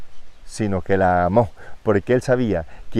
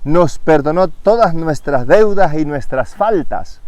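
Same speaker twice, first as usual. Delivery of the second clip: louder and slow, each word weighed; slow proclaimed verse; 5 semitones higher, 6 dB louder than usual. Acted. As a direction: proclaim it slowly from the pulpit. Reads verse slowly and loud.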